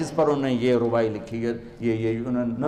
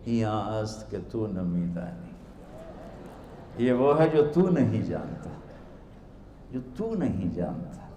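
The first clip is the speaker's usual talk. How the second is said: innocent, gentle; 5 semitones lower, 7 dB quieter than usual. Acted. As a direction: quietly turn his words back on him. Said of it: "बड़ी मासूमियत से बोले".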